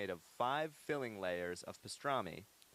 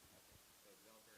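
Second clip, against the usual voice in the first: usual speaking voice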